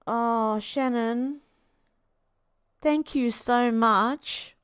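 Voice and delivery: slow, tearful thanks; fighting back real tears; moved to tears by a gift; choked up. Real, not acted. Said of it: "The waterworks are on".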